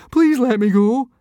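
high, loud desperate begging; pleading. A narrator's reading. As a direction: beg for mercy, near tears. Fishlegs begging the centurion.

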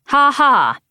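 sarcastic flat ha ha; deadpan mock laugh, loud. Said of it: "That was sarcasm".

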